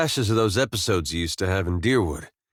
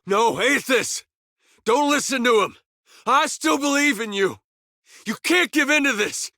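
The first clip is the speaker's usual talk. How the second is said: high, strained cry of protest; desperate urging. Acted. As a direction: call out urgently, pleading not to give in. Desperate plea against a god.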